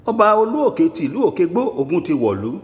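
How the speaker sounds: town crier proclamation. Acted. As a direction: proclaim it like a crier announcing war to every ruler. Proclamation over a list of kings.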